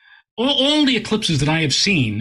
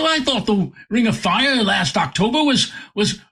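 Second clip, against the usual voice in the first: a touch of a higher British gentleman; 6 semitones higher than usual. Retold or imitated. Quoting the British man.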